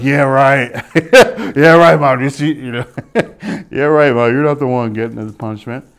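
loud sarcastic retort breaking into laughter; laughing mock scoff. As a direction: imitate a disbelieving kid, laughing through it. Scoffing at mom's line, laughing.

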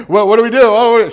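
mock panic. Servants panic at queen.

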